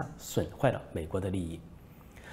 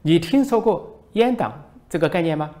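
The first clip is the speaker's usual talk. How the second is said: hammed-up angry lecture, higher and louder; mock scolding; 7 semitones higher, 7 dB louder than usual. Minister loudly scolds the foreign reporter, mock fury. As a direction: play an official blowing up at a reporter. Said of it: "大声训斥外国记者".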